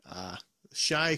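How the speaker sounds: usual speaking voice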